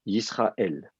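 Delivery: Yisraël said slowly, every syllable clear; careful pronunciation. Shown as a demonstration. Teacher slowly models how to say Yisraël. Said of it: "Donc, on dit".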